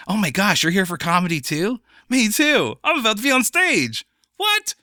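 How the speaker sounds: high-pitched, gushing excitement building as it goes; excited. Acted. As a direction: act thrilled to be going on stage. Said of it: "when I'm telling myself I'm excited".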